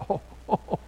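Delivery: breathy laugh through the ohs. Retold or imitated. Grandfather laughing at daughter-in-law's claim, laughing.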